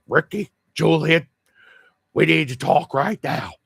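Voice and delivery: a hint of Bubbles calling his friends to talk. A vlogger doing Bubbles for his friend, lightly voiced earnest call. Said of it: "and he talks like this".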